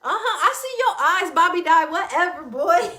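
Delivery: loud, high-pitched teasing call; playful teasing. Real, not acted. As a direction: tease them loudly, breaking into a laugh. Teasing someone who just appeared, laughing.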